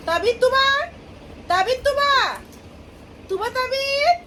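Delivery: names called high and bright to the babies; high playful calling. Real, not acted. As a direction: lift the pitch to catch the babies' attention.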